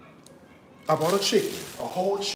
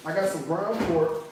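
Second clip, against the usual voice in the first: normal talking voice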